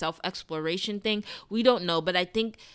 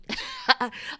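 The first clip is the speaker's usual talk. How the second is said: high giggle. Real, not acted. Laughs at own mean remark.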